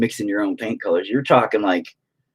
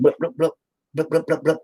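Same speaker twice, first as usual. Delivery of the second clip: quick, high-pitched dabbing bloops; little imitated paint-dab noises; 6 semitones higher than usual. Painter showing how they work, high imitated bloops.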